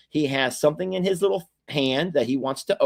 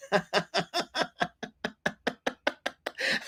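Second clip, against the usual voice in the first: giggles; 6 semitones higher, 8 dB quieter than usual. Real, not acted. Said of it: "I don't mean to get the giggles".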